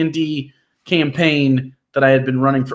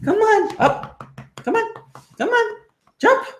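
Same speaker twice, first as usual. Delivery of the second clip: dog voice. Real, not acted. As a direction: coax the dog in a squeaky high voice. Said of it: "this is my dog voice".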